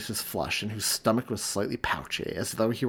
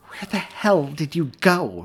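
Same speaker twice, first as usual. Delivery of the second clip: higher, louder, slow angry demand; 7 semitones higher, 7 dB louder, slower than usual. A narrator's reading. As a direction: snap at him in pain and fury. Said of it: "she demanded".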